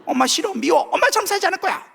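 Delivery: high whiny child voice, mock tantrum. Preacher imitating a child yelling at mom, high mock whine. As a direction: squeal it like a sulking kid. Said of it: "엄마 마음 찢어봤다".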